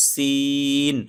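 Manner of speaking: সিন with the vowel held four counts; four-beat long vowel. Shown as a demonstration. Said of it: "এখানে সিন চার টান হবে".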